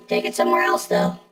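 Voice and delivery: ordinary talk, nothing marked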